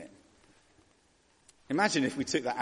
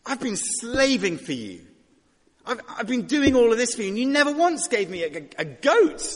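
high, loud aggrieved whine; whining older son; 9 semitones higher, 6 dB louder than usual. Retold or imitated. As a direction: play the resentful son griping to his father. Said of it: "the older son was saying".

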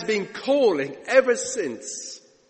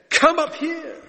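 heavenly summons; 8 semitones higher than usual. Acted. Acts out trumpet-like voice from heaven.

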